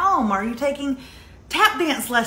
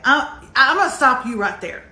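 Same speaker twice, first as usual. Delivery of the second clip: ordinary delivery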